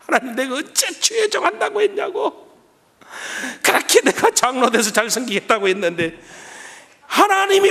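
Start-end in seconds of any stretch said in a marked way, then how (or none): 0.1-6.2 high, resentful protest, coming down; acted-out grumbling prayer; complaining to God. Believer resenting the call to ministry, complaining to God. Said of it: "하나님 앞에서 원망하고 불평해요".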